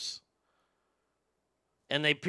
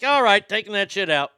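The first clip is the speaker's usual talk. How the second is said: louder, higher, hammed-up giving in; mock resigned exclamation; 5 semitones higher, 9 dB louder than usual. Son called a daughter by dad, loud mock surrender. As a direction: throw up your hands and give in loudly.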